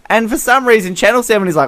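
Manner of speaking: ordinary talk, nothing marked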